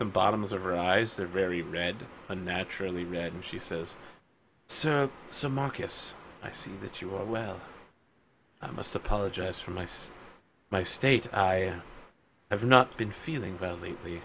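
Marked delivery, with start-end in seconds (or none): 4.7-14.2 soft, halting voice of a sick noblewoman; frail and apologetic, much softer; ailing lady. Acted. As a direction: speak weakly, as if worn down by illness. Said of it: "She's very pale".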